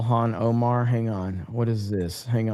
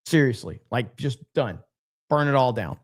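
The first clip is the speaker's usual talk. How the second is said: ordinary delivery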